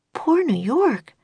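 slow, high pity for the city; sympathetic girl. A narrator's reading. Annie hears of the coming blizzard, slow pitying sigh. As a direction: feel sorry for New York. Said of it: "said Annie".

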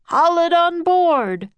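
loud, slow, high command; roaring. A narrator's reading. Professor ordering the octopus brought up, roaring. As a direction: roar the order, drawing it out. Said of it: "roared the professor".

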